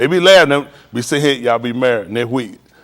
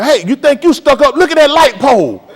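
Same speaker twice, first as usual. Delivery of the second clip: put-on higher and louder, calling after a girl; loud teasing holler; 12 semitones higher, 8 dB louder than usual. Man hollering after a girl who won't look, loud teasing call. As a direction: holler the tease across the street. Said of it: "she say".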